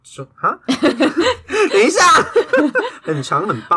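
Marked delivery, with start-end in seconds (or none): 0.4-2.0 words squeezed out through laughter; laughing too hard to talk. Real, not acted. Bursting out laughing mid-chat. 2.1-3.0 shrieking laugh; high burst of laughter. Real, not acted.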